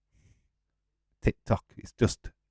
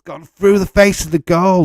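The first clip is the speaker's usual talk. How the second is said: excited; 10 semitones higher, 13 dB louder than usual. Real, not acted. Commentating near miss, shouting.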